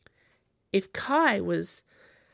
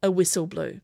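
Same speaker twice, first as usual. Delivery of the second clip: ordinary delivery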